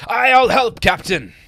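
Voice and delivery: very high, loud, keen sailor voice; eager crewman. Acted. Crewman volunteering to help his captain, high eager shout. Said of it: "volunteers eagerly".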